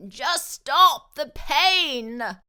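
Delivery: very slow, loud, whining plea; a fox begging, near tears; fox whimpering. A narrator's reading. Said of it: "whimpered the fox".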